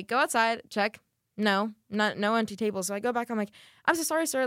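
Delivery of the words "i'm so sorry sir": lightly acted high-pitched apology; apologetic server voice. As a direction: placate the customer, pitched up. Retold apology to angry customer.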